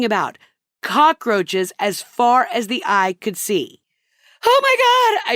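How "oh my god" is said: high, loud shriek of horror; scream. Retold or imitated. Wife sees the cockroach-covered floor and screams. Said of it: "I screamed".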